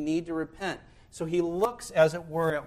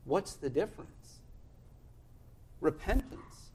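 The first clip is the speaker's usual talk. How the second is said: normal talking voice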